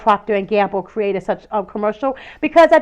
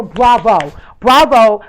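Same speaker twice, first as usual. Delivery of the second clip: louder, proud praise; emphatic bravo; 2 semitones lower, 7 dB louder, much slower than usual. Real, not acted. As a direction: applaud them with weight. Applauding Procter and Gamble.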